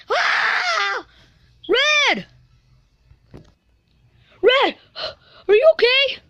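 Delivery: high, loud alarmed calls; shouting. Acted. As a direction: cry out to a friend in trouble. Crying out to a fallen friend.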